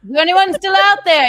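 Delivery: high, loud call into the void. Real, not acted. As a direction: shout it out to the empty room. Calling out to viewers.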